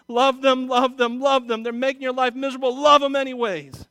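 loud, high, hammering repeats; insistent mantra. Retold or imitated. Pastor hammering a mantra to himself, loud. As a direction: drive each love them home. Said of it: "all I keep on telling myself is".

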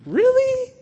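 incredulous friend's high-pitched "Really?". Retold or imitated. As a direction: doubt it out loud, eyebrows up. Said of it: "they look at you like".

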